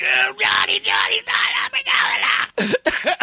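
mock agonized squeal; pained pleading. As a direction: beg frantically to be let go, imitating the victim. Hand crushed, begging for release.

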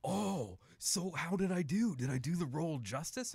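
high, loud, eager actress voice; Marina Sirtis. Retold or imitated. Actress asking a therapist whether she played the role right, imitated eagerly. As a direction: imitate her with big, drawn-out high pitch. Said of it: "And she's like".

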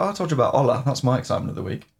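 ordinary talk, nothing marked

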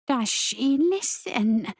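very slow, eerie drawn-out question; wondering spirit voice. A narrator's reading. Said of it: "another wondered".

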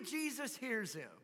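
ordinary talk, nothing marked

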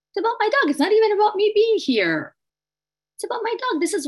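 pouring out feeling for her dog; emotional outcry. Real, not acted. Emotional about her dying dog.

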